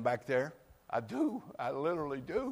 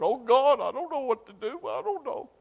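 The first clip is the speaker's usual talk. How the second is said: desperate plea; 12 semitones higher, 8 dB louder than usual. Acted. Distraught plea voiced in story.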